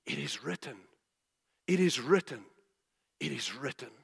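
mostly soft and low, with pauses between; quiet, restrained answer. Acted. Preacher voicing Yeshua's answer to the devil, quietly restrained. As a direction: answer the challenge quietly, letting each repetition settle.

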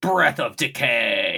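slow, overly bassy monster attack call; too much bass. Retold or imitated. Podcaster imitating Raremon's attack for co-host, overdone bass. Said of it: "I put way too much bass in that voice".

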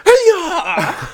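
high, loud mock "hiyah"; over-the-top kick yell. Host re-enacting the serious scene, over-the-top yell. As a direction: ham up a villain's kicking cry. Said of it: "it's just such an over the top".

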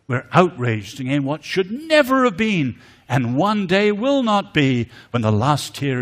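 loud, rising, fervent sermon delivery; impassioned preaching. Acted. Preacher denouncing evil to the congregation: loud fervent preaching. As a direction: thunder it from the pulpit.

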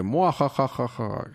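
slow evil villain cackle; mustache twirling villain. Acted. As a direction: laugh it out slow like a cartoon villain. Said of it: "el clon malo ... aparece y dice".

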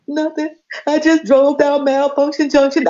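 high tearful mock blubber; imitated crying. Retelling her phone call to Roz, mock crying. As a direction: act out confessing through tears. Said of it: "I'm crying on the phone".

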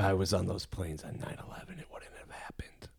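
hushed, unvoiced whisper; mock ASMR whisper. Cohost joining the ASMR bit, whispering. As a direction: whisper a boast as if soothing someone.

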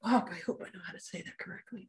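soft, hushed worry to herself; quietly fretting over saying the word right; worried inner voice. Retold or imitated. A speaker seeing a hard word, fretting softly under her breath. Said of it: "I'm like".

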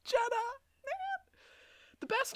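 tearful, high voice; crying. Real, not acted. Host mourning a favourite aunt character, crying. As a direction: still choking up over her. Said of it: "even more emotional for me".

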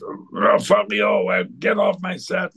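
mock furious sputter; ballistic director. Director throws actress off his set, mock ballistic. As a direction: imitate a director losing it, voice lurching. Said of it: "Tinto Brass went ballistic".

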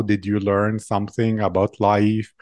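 ordinary talk, nothing marked

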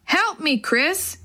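high-pitched urgent call; storyteller's restrained cry for help; mild shout for help. A narrator's reading. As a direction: call for help without much volume. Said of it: "he shouted".

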